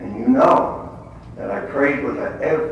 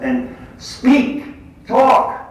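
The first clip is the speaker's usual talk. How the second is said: loud exhortation; 8 semitones higher, 10 dB louder, much slower than usual. Acted. Urging the congregation to evangelize.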